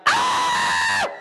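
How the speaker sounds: a short breathy retold shriek; mock scream. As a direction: fake a blood-curdling scream, loud and airy. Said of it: "murderer in the house scream".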